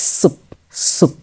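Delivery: সুপ with a joined চ-ছ onset and প at the end; final প sound. Shown as a demonstration. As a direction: close সুপ short on the প. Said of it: "আমি যদি এবার প দিই". (সুপ, শেষে প দিয়ে)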